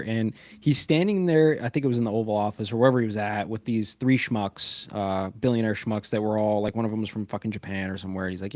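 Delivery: normal talking voice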